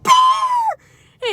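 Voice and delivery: high, loud shout of the name; retold shout. Shouting at the dog Bear, high loud yell. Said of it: "I was like".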